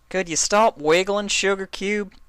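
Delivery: a hint of a patient farm girl; Applejack asking. A narrator's reading. Applejack voiced.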